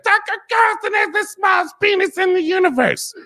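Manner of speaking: high, shrieking bar yell, laughing through it; drunken yell. Retold or imitated. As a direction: belt it across a noisy bar, cackling. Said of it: "this girl literally yelled it from the bar".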